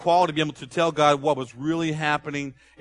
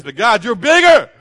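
high, loud burst of praise; David turning from lament to praise; rising praise; 7 semitones higher, 12 dB louder than usual. Retold or imitated. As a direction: lift it into triumphant praise. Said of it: "You can see it in his voice".

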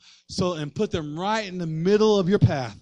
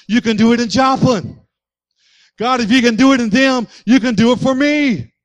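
loud, building declaration to God; fired-up preacher in bold prayer; full-force preaching; 5 semitones higher, 10 dB louder than usual. Real, not acted. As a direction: drive each phrase harder, rising to a shout.

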